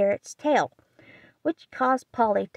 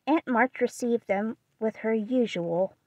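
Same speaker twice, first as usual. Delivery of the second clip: ordinary delivery, nothing marked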